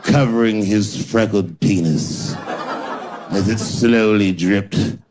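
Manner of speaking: slow, grandiose newspaper narration; mock literary prose. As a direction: read it like overwrought prize-winning journalism. Mock Pulitzer prose parody.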